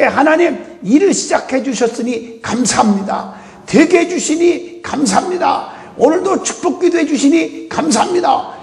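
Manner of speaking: high, ringing thanks to God; fervent prayer. Shown as a demonstration. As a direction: cry out your thanks joyfully.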